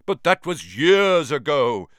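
strange, loud fretting spider voice; fretful old spider. A narrator's reading. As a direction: fret like an ancient spider. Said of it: "said Aragog fretfully".

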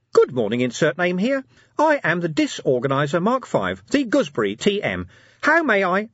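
high, loud, eager little imp; chirpy gadget imp. Acted. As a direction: chirp it out like a tiny machine sprite. Imp's voice.

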